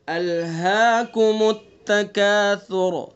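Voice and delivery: slow melodic tilawat with long drawn vowels; slow Quran recitation. Shown as a demonstration. Teacher recites the surah for students to copy, slow melodic recitation. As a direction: chant each verse slowly, stretching the vowels. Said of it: "ٹھیک ہے؟".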